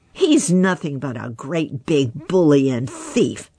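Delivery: plain reading voice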